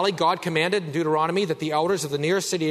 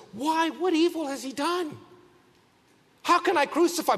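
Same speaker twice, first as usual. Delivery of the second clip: Pilate's protest, much higher and strained; pleading Pilate; 12 semitones higher than usual. Retold or imitated. Pilate pleading with the crowd, pitched high. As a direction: cry out why as the governor. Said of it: "One more time he says".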